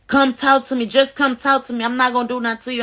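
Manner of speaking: imitating the ex, pleading; mock ex-husband. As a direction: coax her out, voice lifted. Ex pleading at door, retold.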